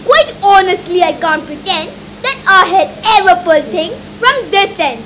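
loud, gleeful dentist relishing the job; booming delighted dentist; gleeful cry. Acted. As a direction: crow it out with glee. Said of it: "he cried with obvious glee".

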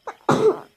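sudden loud sneeze; sneezing. Real, not acted. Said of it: "hapşurdum".

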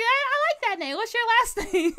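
high excited chatter with a laugh; excited kid. Retold or imitated. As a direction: gush like an eager kid. Excited kid meeting friend.

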